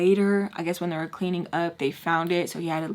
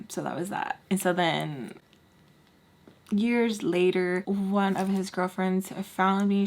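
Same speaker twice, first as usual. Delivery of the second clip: ordinary talk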